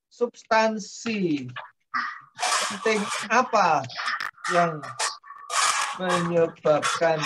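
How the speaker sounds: slow, word by word dictation. Shown as a demonstration. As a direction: spell it out slowly for note taking. Lecturer dictates the point slowly.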